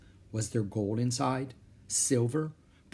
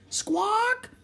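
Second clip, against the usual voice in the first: piercingly high bird screech; parrot squawk; 3 dB louder than usual. A narrator's reading. Parrot circling over the pirates, squawking. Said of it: "she squawked".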